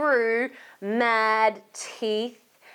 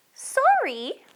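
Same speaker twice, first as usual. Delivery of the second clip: high sorry little voice; Molly voice; 12 semitones higher, 3 dB louder than usual. Acted. Molly apologising to Marvin, high apologetic voice. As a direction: say it like a small apologetic friend. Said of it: "said Molly".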